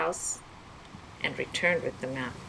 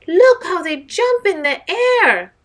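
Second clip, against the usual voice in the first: much higher, much louder shriek; 13 semitones higher, 13 dB louder than usual. Acted. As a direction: shriek with delight at the jumping whales.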